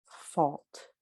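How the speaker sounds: fault with a rounded AW, as in bought; rounded fault, like FAWLT; aw vowel. Shown as a demonstration. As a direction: say fault with the open-back AW vowel. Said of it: "that aw vowel".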